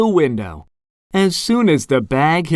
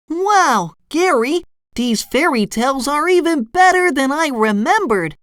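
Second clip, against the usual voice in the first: high, excited cartoon voice; SpongeBob exclaiming; 9 semitones higher than usual. A narrator's reading. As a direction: exclaim in wonder, pitched way up. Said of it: "SpongeBob exclaimed".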